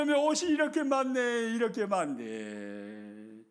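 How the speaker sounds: laughing, amazed delight; delighted surprise. Acted. Homemaker seeing laundry as blessing, delighted amazement. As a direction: marvel at the blessing. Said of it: "생각을 딱 바꾸는 거예요".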